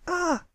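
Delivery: startled high yelp of pain at the noise; wince. Acted. Colt covers his ears at the fillies' shout, high yelp. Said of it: "Pipsqueak winced".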